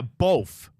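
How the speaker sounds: "both" as a stretched "bolth". Shown as a demonstration. Host insisting on his own version, "both" as "bolth". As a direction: lean on "both" with an L before the th. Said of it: "I hear you say an L".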